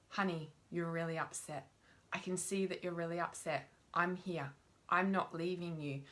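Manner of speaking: soft, low, reassuring parent voice; gently calm an upset child; soothing parent. Shown as a demonstration. Parent soothing an upset child, soft and low.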